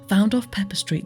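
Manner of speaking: ordinary talk, nothing marked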